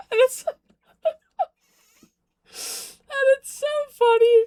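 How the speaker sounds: high, loud, laughing through the words; can't stop giggling as you say it; giggling fan gushing about an actor. Real, not acted.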